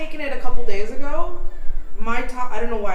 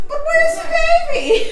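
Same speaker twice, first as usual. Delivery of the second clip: put-on high, slow toddler voice asking; little girl voice; slower than usual. Mother imitating her daughter asking for the baby, high child voice. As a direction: squeak it like a small child. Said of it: "and she goes".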